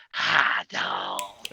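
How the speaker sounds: raspy smoker's rasp, quiet. Retold or imitated. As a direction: rasp it out like an old chain smoker. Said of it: "you gotta do the raspy voice".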